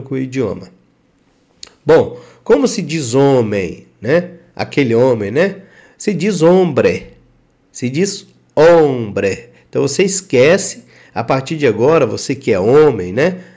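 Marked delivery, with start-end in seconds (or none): 6.0-9.5 hombre said slowly with silent h, obre; slow clear model word hombre; careful teacher demo of hombre; Spanish hombre. Shown as a demonstration. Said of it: "Como escreve e como pronuncia".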